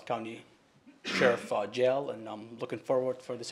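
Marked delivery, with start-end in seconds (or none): none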